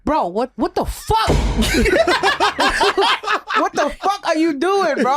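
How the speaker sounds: high, laughing disbelief; exasperated laugh. Real, not acted. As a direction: cry out in disbelief. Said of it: "exasperated outburst".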